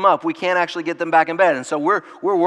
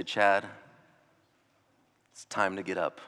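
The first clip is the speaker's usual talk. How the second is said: soft, low, tender waking call; gentle Savior; 7 semitones lower, 7 dB quieter than usual. Retold or imitated. The Savior waking Chad at the resurrection, tender and soft. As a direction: wake him gently, like a loving father. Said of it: "hear my Savior's voice say".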